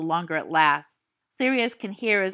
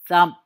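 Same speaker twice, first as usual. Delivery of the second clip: heavy thump; 8 dB louder than usual. Acted. Thump sound effect.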